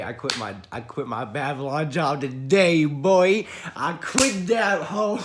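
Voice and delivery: loud, rising triumphant holler; hyped-up shouted brag; gleeful boast. Real, not acted. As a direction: crow it like a huge win, stretching the last words. Announcing the quit job with glee.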